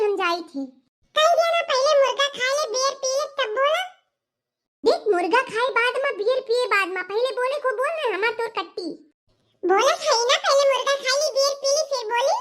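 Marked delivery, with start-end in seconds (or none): 1.2-12.4 put-on higher playful girlfriend voice; coy girlfriend insisting. Girlfriend teasing boyfriend before saying I love you. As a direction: tease him, make him wait.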